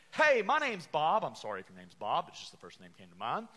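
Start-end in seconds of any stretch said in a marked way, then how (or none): 0.1-1.2 high, loud, cheery greeting; mock false teacher. False teacher greeting the church, in a high, loud, cheery mock voice. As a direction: play a false teacher brightly introducing himself. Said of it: "they'll open up those doors and say".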